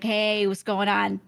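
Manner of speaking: slow, drawn-out Italian-American greeting; Italian-American accent. Retold or imitated. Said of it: "I say it in, like, you know, an accent".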